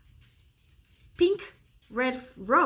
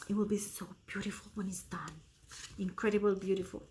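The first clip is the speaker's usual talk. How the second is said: soft, much quieter dreamy whisper; whispered admiration; 3 semitones lower, 6 dB quieter, faster than usual. Real, not acted. Admiring her stitching, whispering quietly. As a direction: hush it like a secret delight.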